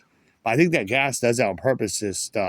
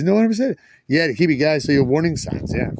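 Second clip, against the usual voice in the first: usual speaking voice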